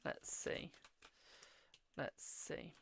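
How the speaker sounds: slow whisper under her breath; whispered muttering. Real, not acted. Reader pulling cards for Keith, slow muttered whisper. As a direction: hush it to yourself as you pull cards.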